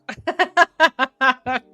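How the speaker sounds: big real laugh that falls away; loud burst of laughter. Real, not acted. Solving a crossword, laughs out loud at clever clue. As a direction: crack up loudly at the clue. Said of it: "That is funny".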